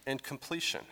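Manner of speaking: normal talking voice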